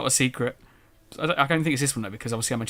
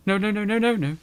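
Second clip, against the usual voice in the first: higher, rapid string of refusals; mild panic; 8 semitones higher than usual. Real, not acted. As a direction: blurt it out in rising alarm. Panicking at a wrong turn in game.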